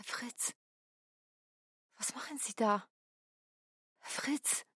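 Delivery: tonlos geflüstert, ganz leise, fassungslos; erschrockenes Flüstern. Acted. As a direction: haucht seinen Namen vor Schreck. Finds Fritz bleeding, calls him. (Piotra flüstert erschrocken den reglosen Fritz an)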